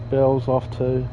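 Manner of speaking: usual speaking voice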